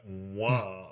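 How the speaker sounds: low-key, soft little Wah; a soft, dainty mock war cry; overtly effeminate. Shown as a demonstration. Joking about a harmless button sound, overtly effeminate soft war cry. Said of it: "effeminate or something".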